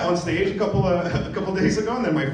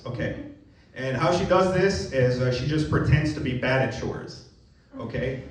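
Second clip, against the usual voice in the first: ordinary talk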